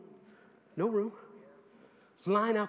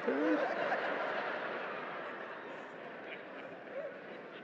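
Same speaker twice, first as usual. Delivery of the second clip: breathy laugh; 10 semitones higher, 5 dB quieter than usual. Real, not acted.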